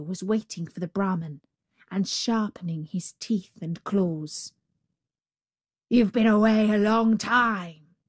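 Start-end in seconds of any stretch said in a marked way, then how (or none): 5.9-8.0 growled. Acted. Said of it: "growled the savage beast".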